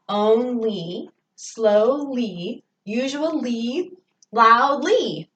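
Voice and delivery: "Only, slowly, usually, loudly" with each final "ly" picked out; stressed LY endings. Shown as a demonstration. Teacher shows the shared ending, stressing "ly" in "Only, slowly, usually, loudly". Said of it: "they all end in L Y".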